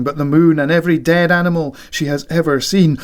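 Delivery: ordinary talk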